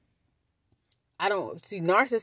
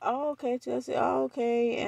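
higher, lightly mocked "oh okay"; imitated cousin; 7 semitones higher, 3 dB quieter, slower than usual. Cousin reacting after getting excited, lightly imitated in higher voice. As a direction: lightly mimic her in a raised voice. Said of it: "after she got excited".

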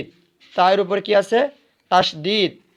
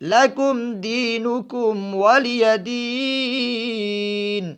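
very slow, higher, melodic chant with long held vowels; Quranic recitation; 5 semitones higher, 5 dB louder, much slower than usual. Shown as a demonstration. Teacher reciting the surah for the pupils, slow melodic chant. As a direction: recite the closing verses like a tajweed reciter. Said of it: "কিভাবে?".

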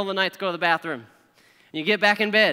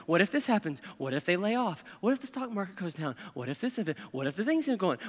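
hushed, anxious string of worries; fretting what-ifs; 7 dB quieter than usual. Acted. Worrier playing the what if game, softly fretting. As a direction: mutter imagined worries softly to yourself.

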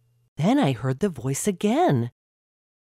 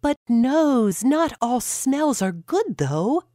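much higher mouth voice cutting in; the mouth interrupting the nose, much higher; mouth objecting; 9 semitones higher than usual. A narrator's reading. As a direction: butt in on the nose, high and pointed.

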